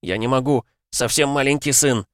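high, tearful begging; pleading. A narrator's reading. Constable begs his chief not to make him die. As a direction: plead desperately, voice rising. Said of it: "взмолился участковый".

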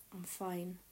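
usual speaking voice

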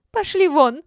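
very high, very loud screech; ultrasonic shriek. A narrator's reading. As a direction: scream them out, pitch through the roof. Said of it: "перешла на ультразвук Олечка".

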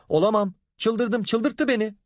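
high-pitched, frantic outrage; furious refusal. A narrator's reading. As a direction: protest as if driven mad. Woman refuses to calm down.

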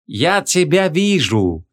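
high, slow, sweet teasing voice; sugary sorcerer. A narrator's reading. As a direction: coo it slowly in a sugary high voice. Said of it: "с очень сладеньким голосом". (сладенький высокий протяжный голос)

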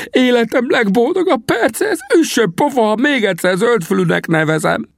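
very high, exuberant cry; squeaky-high elated exclaiming; overjoyed gushing. A narrator's reading. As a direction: bubble over with joy for a friend. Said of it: "kiáltotta lelkendezve".

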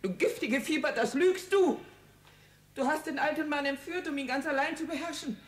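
laut schreiende, höhere Anklage, zum Ende schneller; unbeherrscht wütend. Acted. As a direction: schrei die Tochter außer dir an. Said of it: "So unbeherrscht". (Mutter schreit die Tochter zornig an)

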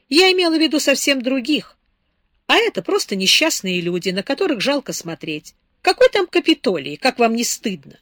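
a hot, indignant outburst, rising in pitch; flaring up. A narrator's reading. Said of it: "вспыхнула Беатрис".

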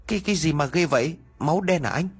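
high, fast, panicked question; startled alarm. Acted. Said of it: "nhìn thấy hoảng hồn rồi nói".

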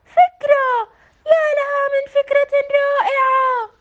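loud, high eureka cry; excited. A narrator's reading. Sara realizing the solution, excited loud cry. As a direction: burst out with the idea.

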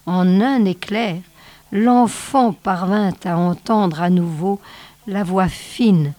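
ordinary delivery, nothing marked

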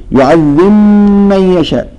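يعذب من يشاء with the ب dropped and the م doubled; merged ba into meem. Shown as a demonstration. Teacher demonstrates merging ba into meem for students. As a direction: say يعذب as يعذمّ, melting the ba into a stressed meem. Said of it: "الباء تختفي ونشدد الميم".

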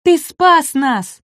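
high, drawn-out delighted cry; small dinosaur cheering his saviour; admiring exclamation. A narrator's reading. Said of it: "воскликнул Шишка".

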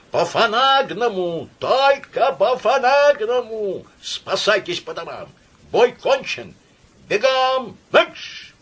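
high, drawn-out howled command; bugle-like howl. A narrator's reading. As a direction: blare it like a cavalry trumpet. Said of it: "провыл, как кавалерийская труба".

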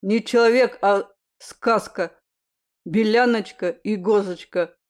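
loud, with "Гозочка" for Розочка; says w for r. Acted. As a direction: swallow the r in "Гозочка". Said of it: "картавя подхватил второй".

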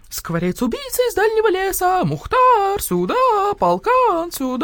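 high, loud crowing call for help; piercing scream. A narrator's reading. Rooster calls the guard dogs, piercing scream. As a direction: crow frantically for the dogs. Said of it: "заорал Петюня ещё пронзительнее".

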